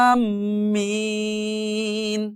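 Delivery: আমিন with a very long level আ, humming ম, lengthened মি; four-alif stretch. Shown as a demonstration. Teacher demonstrating madd to learners, আমিন with a long-held opening আ. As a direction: hold the আ of আমিন four counts, then hum the ম. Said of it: "এই আটা চার আলিফ আগে টানতে হবে".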